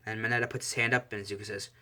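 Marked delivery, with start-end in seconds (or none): none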